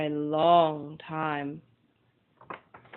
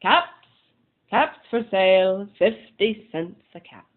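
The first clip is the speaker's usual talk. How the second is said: louder hawker's call; street seller calling; 6 dB louder than usual. Acted. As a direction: call out your wares to the street.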